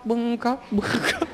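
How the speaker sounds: "bưng cốc" repeated quietly through a chuckle; laughing mock bell. Shown as a demonstration. Monk joking about clunking bells, quiet laughing "bưng cốc". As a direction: giggle out "bưng cốc" softly. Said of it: "không buông được thì bưng cốc".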